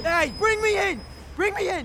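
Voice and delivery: high, desperate yells to be pulled back in; terrified shouting. Acted. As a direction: scream for your life, begging to be hauled up. Hanging out of the window.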